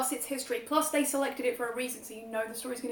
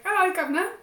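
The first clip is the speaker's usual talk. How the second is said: higher, louder cockney "Hello, Governor" with a rising end; mock cockney; 7 semitones higher, 8 dB louder than usual. Imitating the host's cockney with a chirpy "Hello, Governor". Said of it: "Dave Anthony's is like".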